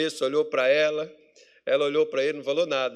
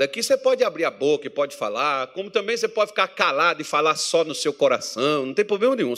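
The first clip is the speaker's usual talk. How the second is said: usual speaking voice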